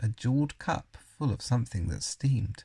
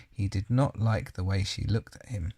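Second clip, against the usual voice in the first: plain reading voice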